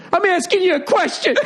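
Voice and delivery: high, whiny laughing sob; mock crying. Italian owner to Gordon, high mock tearful wail. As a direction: wail it like a big guy breaking down. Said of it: "He goes".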